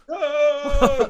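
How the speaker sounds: long high, loud "oh"; Sam Neill cry. Retold or imitated. Quizmaster retelling the ship blowing up, held high cry. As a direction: cry out and hold it till cut short. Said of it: "just enough time for Sam Neill to go".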